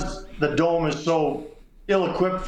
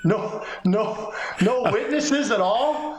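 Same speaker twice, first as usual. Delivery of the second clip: higher mock nervous question, slowing at the end; 4 semitones higher, slower than usual. Reporter joking nervously about meeting the GM alone, mock fear. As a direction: play at being scared to go alone.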